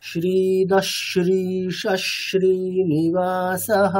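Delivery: শ্রীদশ্রীশ শ্রীনিবাসহ read very slowly, syllable by syllable; slow recitation demo. Shown as a demonstration. Teacher reads Sanskrit example slowly to students, careful recitation. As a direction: spell out শ্রীনিবাসহ slowly so students hear the visarga. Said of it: "এরকম হবে".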